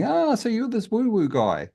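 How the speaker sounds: high mock voice of curious judo guys; quoting judo mates in a high teasing voice. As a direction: squeak it out like a nosy mate. Judo people quizzing him, mock voice.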